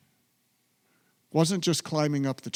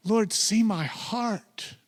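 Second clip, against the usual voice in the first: slow prayer; 6 semitones higher, much slower than usual. Shown as a demonstration. Slow prayer led for congregation.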